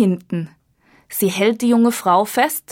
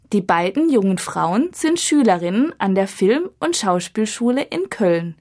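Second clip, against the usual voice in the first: ordinary talk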